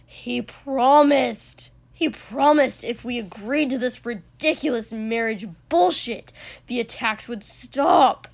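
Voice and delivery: loud, anguished outburst; furious, wounded yelling; livid, almost pleading. A narrator's reading. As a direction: shout it as a desperate accusation. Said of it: "it almost sounded like he was pleading".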